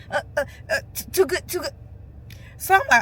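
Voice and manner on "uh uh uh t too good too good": mock cashier stammering in a fluster. Imitating the flustered cashier who keeps counting. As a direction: stumble over every word like a caught-out cashier. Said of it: "So the lady like".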